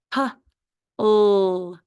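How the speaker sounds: P-ul as short "puh", pause, long "ull"; sounded out. Shown as a demonstration. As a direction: stretch P-ul into two separate sounds. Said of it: "Do the second syllable".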